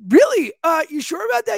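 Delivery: very high, incredulous questioning; retold disbelief. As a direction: squeak up in astonished doubt. Retells incredulous reply to manager.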